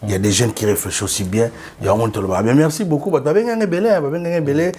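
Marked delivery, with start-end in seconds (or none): none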